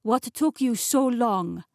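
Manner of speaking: put-on huffy French chef accent. A narrator's reading. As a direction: demand an explanation as a French chef. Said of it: "in an unmistakably French accent".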